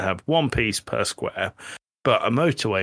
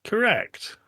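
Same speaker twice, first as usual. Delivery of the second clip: quiz host; 11 semitones higher than usual. Acted. Quiz master confirms answer.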